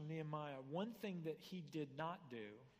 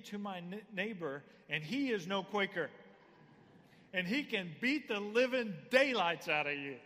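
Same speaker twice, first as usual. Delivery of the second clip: louder, hammed-up Quaker scolding his donkey in old thee-and-thou speech; exasperated Quaker; 3 semitones higher, 10 dB louder than usual. Quaker warning his stubborn donkey, mock-exasperated and loud. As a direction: play a long-suffering Quaker losing patience, building to a loud threat. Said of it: "he says".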